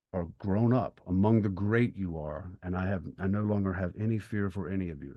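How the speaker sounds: normal talking voice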